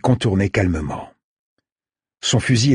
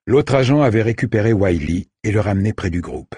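usual speaking voice

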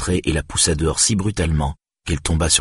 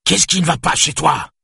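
very high, exasperated shout; 12 semitones higher, 4 dB louder than usual. A narrator's reading. Exasperated father hunting for a hairbrush, shouting. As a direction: explode at the child in anger. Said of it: "cria-t-il".

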